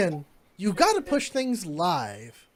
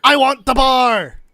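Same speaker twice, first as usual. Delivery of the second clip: louder, slow and insistent; demanding bar buyer; 4 semitones higher, 10 dB louder than usual. Acted. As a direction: lay down the offer like it's final. Stumpy man demands the bar.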